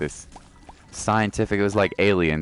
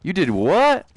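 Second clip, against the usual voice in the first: amazed; 12 semitones higher, 5 dB louder, slower than usual. Real, not acted. Amazed reaction.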